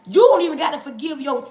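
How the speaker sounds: normal talking voice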